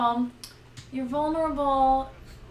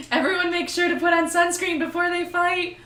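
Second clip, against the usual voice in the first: higher, louder, fussy warning; fussy healer; 7 semitones higher, 6 dB louder than usual. Retold or imitated. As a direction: nag the party like a mom. Mock healer quote.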